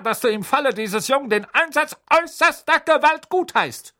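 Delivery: high, loud harsh demand; harsh aunt. Acted. Aunt telling Petunia to demand harsher beating. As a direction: urge the caning. Magda voiced in story.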